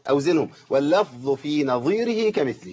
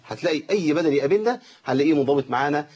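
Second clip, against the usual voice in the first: ordinary talk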